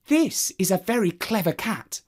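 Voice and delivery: high admiring sigh; admiring woman. Acted. Woman admiring the cat, high. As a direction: marvel at the cat.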